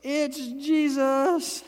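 high, loud, slowed cheerful call; joyful Jesus. Retold or imitated. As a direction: greet the storm-tossed men with joy. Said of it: "he's like".

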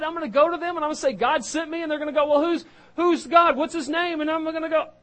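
high, fast, flustered rambling; panicky Moses. Acted. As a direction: babble like a man out of his depth. Moses panics about what to say.

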